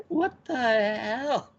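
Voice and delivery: high, very drawn-out disbelief; appalled disbelief. Retold or imitated. Viewer reacting to repeated resurrections, drawn-out appalled disbelief. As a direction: stretch it out, aghast and high. Acting own shocked reaction.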